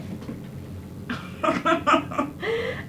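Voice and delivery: high, exaggerated whimpering sobs; fake-cry in comic despair; mock sobbing. Mock despair as dog resists grooming.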